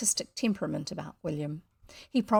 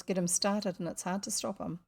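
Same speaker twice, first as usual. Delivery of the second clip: ordinary delivery, nothing marked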